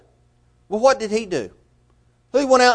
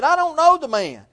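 a hint of fear, pitched much higher; fearful denial; 8 semitones higher than usual. Peter, scared for his life, denies Jesus, lightly frightened. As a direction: deny it like a man afraid for his life. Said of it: "being fearful of his life".

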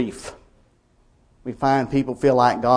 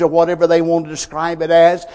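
ordinary talk

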